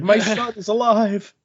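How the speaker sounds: high, drawn-out imitation of crying relief; mock tearful joy. Father crying over his returned son, mock tearful. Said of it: "And he's crying".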